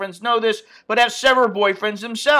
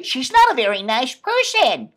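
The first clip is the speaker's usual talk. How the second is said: mock polite; 5 semitones higher than usual. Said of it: "I could be politically correct".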